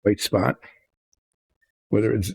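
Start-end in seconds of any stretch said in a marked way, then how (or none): none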